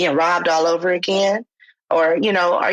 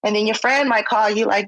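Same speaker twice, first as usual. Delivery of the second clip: ordinary talk, nothing marked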